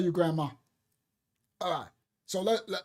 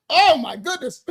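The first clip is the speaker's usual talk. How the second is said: grandma; 4 semitones higher, 8 dB louder than usual. Retold or imitated. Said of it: "my grandma'd be like".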